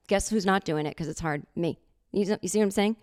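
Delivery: normal talking voice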